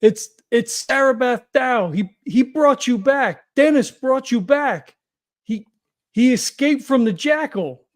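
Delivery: slow, loud appeal to someone out of control; yelling out. Acted. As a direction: plead at full voice, spacing the words out. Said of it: "Harry just yells out".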